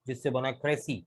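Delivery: usual speaking voice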